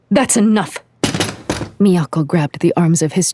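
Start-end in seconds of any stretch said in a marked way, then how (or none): 0.1-0.8 sharp angry shout. A narrator's reading. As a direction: cut him off with a yell. Miyako cutting him off.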